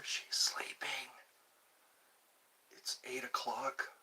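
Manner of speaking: hushed whisper so mother stays asleep; breathy whisper, don't wake her. Real, not acted. Streamer whispering to chat while his mother sleeps. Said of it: "mother asleep nearby".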